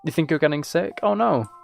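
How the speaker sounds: usual speaking voice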